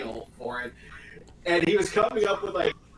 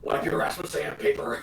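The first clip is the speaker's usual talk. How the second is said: lightly done raspy Batman growl; mock-gruff Batman delivery. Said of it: "do you have to say it like Batman".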